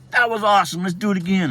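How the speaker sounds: high, loud, eager little brother; imitated thrilled kid. Little brother popping up off the floor, thrilled. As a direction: burst out like a kid wanting another go.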